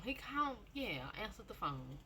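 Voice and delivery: ordinary delivery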